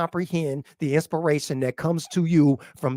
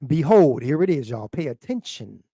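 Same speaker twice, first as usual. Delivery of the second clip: ordinary talk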